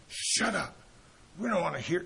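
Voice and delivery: half-voiced imitation of a furious heckler; mock angry yell. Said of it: "screaming at this guy".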